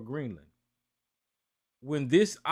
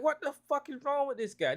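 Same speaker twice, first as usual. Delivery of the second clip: incredulous outburst; 9 semitones higher than usual. Retold or imitated. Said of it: "And I'm like".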